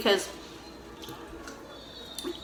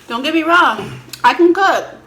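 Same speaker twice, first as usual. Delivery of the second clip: defensive; 7 semitones higher, 8 dB louder than usual. Real, not acted. Wife insists she can cook.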